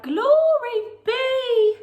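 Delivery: high, loud, slow exclamation of dismay; old lady's cry. Acted. Old lady crying out as the goat wrecks her house, high and loud. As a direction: throw up your hands and cry it out. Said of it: "The little old lady cried".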